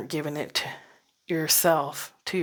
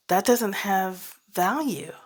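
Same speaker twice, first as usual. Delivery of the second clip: ordinary delivery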